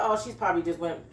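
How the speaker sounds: ordinary delivery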